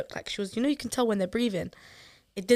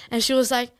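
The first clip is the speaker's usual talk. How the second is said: normal talking voice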